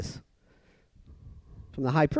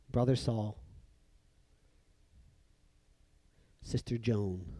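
soft, low, unhurried address; tender greeting; 6 semitones lower, 12 dB quieter than usual. Acted. Believers walking up to one another, softly greeting. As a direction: offer each name quietly, with a long pause between.

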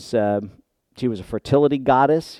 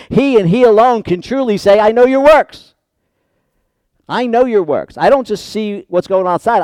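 raised, ringing sermon delivery; impassioned preaching; 8 semitones higher, 8 dB louder than usual. Real, not acted. Preacher driving home Christ's knowledge of his church, impassioned preaching. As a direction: preach it louder and higher.

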